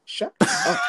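high laughing shout; squealing laugh. Real, not acted. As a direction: shriek it through laughter. Laughing banter with Chris.

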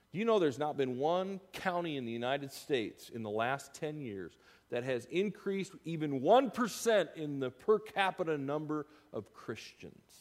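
ordinary talk

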